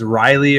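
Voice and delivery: "Riley" said RYE-lee; "Riley", rhyming with highly; regional pronunciation. Shown as a demonstration. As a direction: say "Riley" with a long eye vowel first. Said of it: "everyone down this way says".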